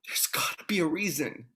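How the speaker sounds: breathless whisper, all hope gone; despairing whisper. Acted. Man who wants redemption whispers in despair. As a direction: whisper it to yourself, beaten.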